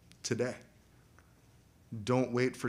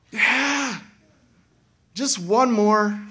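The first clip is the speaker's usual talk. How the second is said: slow, higher pleading excuse; mock Pharaoh; 9 semitones higher, 6 dB louder, much slower than usual. Preacher voicing Pharaoh's excuse, slow and mocking. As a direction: drag it out like a lazy excuse.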